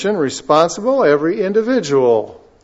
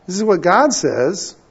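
ordinary talk, nothing marked

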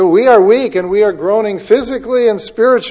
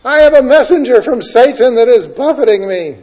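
high, pleading cry rising to a louder "away"; crying out to God; 5 semitones higher, 3 dB louder than usual. Retold or imitated. Paul begging the Lord, raised pleading cry. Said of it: "He cried out to the Lord".

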